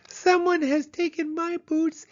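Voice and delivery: very high wailing cub, slowing at the end; wailing Baby Bear. Acted. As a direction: wail it like a tiny cub who lost her boots. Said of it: "she wailed".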